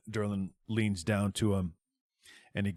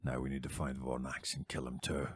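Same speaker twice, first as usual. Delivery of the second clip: low grim resolve; 6 semitones lower, 5 dB quieter than usual. Acted. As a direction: lower and quieter. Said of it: "Durlin's resolve".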